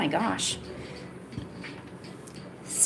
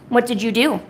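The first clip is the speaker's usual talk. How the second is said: usual speaking voice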